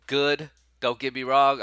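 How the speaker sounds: ordinary talk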